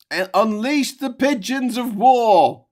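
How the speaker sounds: high, slow, loud war cry; mock battle cry. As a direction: bellow it like a general rallying troops.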